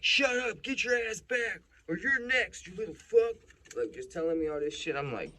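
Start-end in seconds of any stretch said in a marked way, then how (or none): 0.0-3.4 loud, very high shouted cop imitation; furious cop threat. Cop threatens the watching kid, shouting furiously. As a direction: act out an officer screaming threats.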